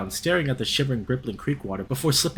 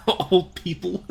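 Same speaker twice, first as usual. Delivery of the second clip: laughing; 7 semitones higher, 3 dB louder, slower than usual. Real, not acted. Mocking old folks again.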